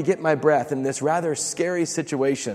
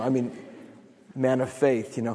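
ordinary talk, nothing marked

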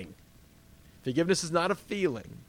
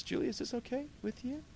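much higher, softer asking; gentle Peter question; 10 semitones higher, 7 dB quieter than usual. Acted. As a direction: check kindly with her. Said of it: "turns to you and says".

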